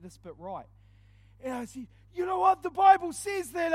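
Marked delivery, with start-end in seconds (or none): 2.1-3.7 much higher and louder, a hammed-up preacher's shout; belting the big line from up on the chair; mock power preach. Preacher on a chair, aping Matty, shouts the big line.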